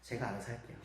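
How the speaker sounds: soft muttered inner retort; inner reply. Retold or imitated. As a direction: say it as if only thinking it. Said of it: "저는 속으로". (속으로 삼키는 작은 말투)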